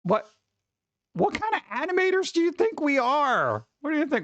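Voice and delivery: high-pitched, put-on affronted voice; mock indignation. Streamer answering a viewer's episode request, mock indignant.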